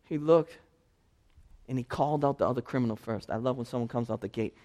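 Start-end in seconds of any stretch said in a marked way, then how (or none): none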